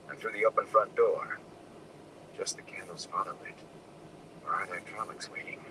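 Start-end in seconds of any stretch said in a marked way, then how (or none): 2.4-5.6 hushed anxious whisper; fearful thought under breath. A narrator's reading. Rand's fearful inner thoughts.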